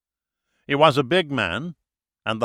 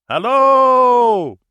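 long, high, booming call; loud hail; 14 semitones higher, 11 dB louder than usual. A narrator's reading. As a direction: cup your hands and holler across the water. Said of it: "came out with a loud".

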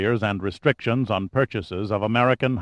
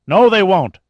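a loud, high, furious no; sharp angry shout; 8 semitones higher, 8 dB louder than usual. A narrator's reading. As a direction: shout him down, rising to his feet. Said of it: "the president shouted".

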